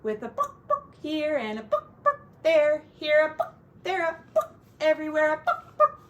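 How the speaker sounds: light mock chicken bucks; chicken clucks. Parent clucking like the song's chickens, playful. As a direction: cluck like a hen, sing-song.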